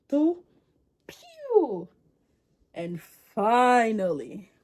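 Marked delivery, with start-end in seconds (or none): 0.1-1.9 mock gunshot. King counts down and shoots.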